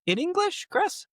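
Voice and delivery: high, teasing sing-song nudge; playful high prompt. Acted. Host nudging co-host to answer in English, squeaky high voice. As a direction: coax him in a squeaky high voice.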